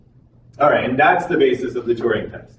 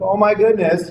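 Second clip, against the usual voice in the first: higher-pitched, lightly acted astonishment; mock amazement; 7 semitones higher than usual. Scientist reacting to a talking cow, mock amazement. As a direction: play at being amazed, pitch lifted.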